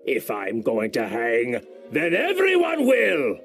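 furious threat. Acted. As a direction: swear to take them all down. Captain shouting during quarrel.

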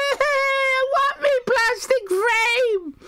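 put-on whining little kid, very high; slow, screechy mock-child whine; Irish Becky. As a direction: wail it like a child demanding a prize. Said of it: "Irish Becky go".

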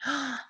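mock-surprised gasp; excited gasp. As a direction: gasp as if impressed by a child's answer. Surprise at children's supposed answer.

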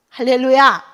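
loud exultant hallelujah; joyful shout. Real, not acted. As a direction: call it out to the congregation. Preacher calls out to congregation.

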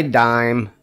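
ordinary delivery, nothing marked

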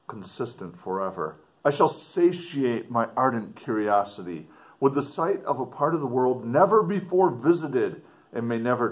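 dramatic reading. Acted. Teacher reading the explorer's letter aloud to students, expressive. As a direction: read it out to the class with feeling.

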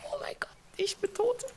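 high breathy whispered wail; despairing whisper. Real, not acted. Gamer dying in survival game to friend, despairing whisper. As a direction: gasp it out as you die.

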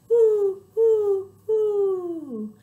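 high, loud owl hoots. Shown as a demonstration. Owl flaps his wings in rhyme, high hoots. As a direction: hoot high, last one longer. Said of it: "flaps his wings and says".

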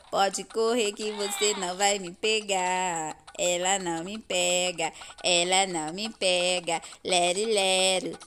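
slow singsong teasing; mock the chaser, drawn out; taunting. Real, not acted. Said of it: "eu fiquei zombando dela".

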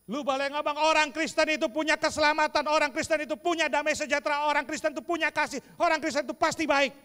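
loud, high preaching, quick on the last line; full force preaching. Acted. Preacher to congregation, loud and rising preaching. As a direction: hammer it out from the pulpit. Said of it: "preaching at full force".

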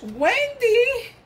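high, thrilled squeal of the name; excited squeal. Real, not acted. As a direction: squeal her name with delight. Excited thanks for gifts.

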